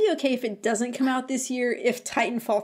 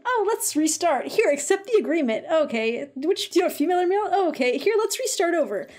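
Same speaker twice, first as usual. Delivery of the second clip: higher-pitched, singsong imitation of a looping game; mock-cheery prompts; 8 semitones higher than usual. Player retelling an endless loading loop, mock-cheery. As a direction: reel off the prompts in mock eagerness.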